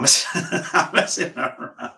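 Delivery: laughing through the words. Real, not acted. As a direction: chuckle while taking it back. Laughing off his own joke.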